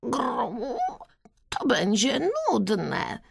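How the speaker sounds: soft pigeon coos, then slow complaint; Gienek, grumbling. A narrator's reading. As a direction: coo like a pigeon, then object with distaste. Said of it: "skrzywił się Gienek".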